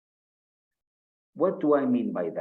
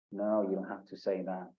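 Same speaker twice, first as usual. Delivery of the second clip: ordinary talk, nothing marked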